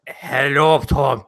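slow mock French-Spanish accent; French-Spanish puppet accent. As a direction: introduce yourself as a puppet, half French, half Spanish. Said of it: "like a cross between French and Spanish".

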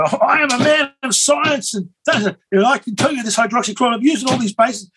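high-pitched, declamatory mock testimony; impassioned testifying doctor. Doctor testifying to the Senate, impassioned and high. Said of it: "and he says, you know".

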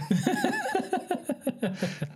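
long high laugh; high laughing fit. Real, not acted. Laughing fit over name.

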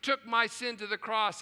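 ordinary talk, nothing marked

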